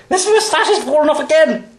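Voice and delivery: high-pitched exasperated cry; sudden high yelp of frustration; exasperated outburst. Real, not acted. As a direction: cry out as the costume fails again. Exasperated at slipping costume moustache.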